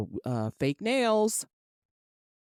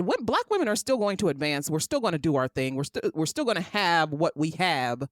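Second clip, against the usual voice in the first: ordinary talk, nothing marked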